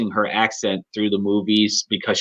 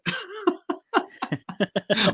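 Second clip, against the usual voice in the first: a high, breathy giggle that slips out; real high laugh at the accent; 7 dB quieter than usual. Real, not acted. Laughing at Wanda's forgotten accent.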